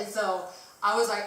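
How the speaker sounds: ordinary talk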